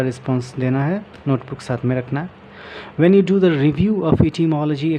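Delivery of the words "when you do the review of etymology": slow, careful reading from the book; reading aloud. Shown as a demonstration. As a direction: read each phrase out slowly for learners. Reading book text aloud slowly. (किताब से धीरे-धीरे पढ़ना)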